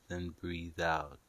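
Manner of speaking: usual speaking voice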